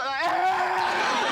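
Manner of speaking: mock hysterical scream through laughter; panicked scream. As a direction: scream like a kidnapped mum. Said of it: "mum's like".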